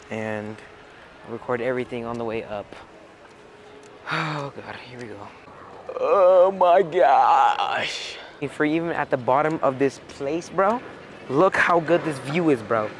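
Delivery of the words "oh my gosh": awestruck. Real, not acted. First sight of the view.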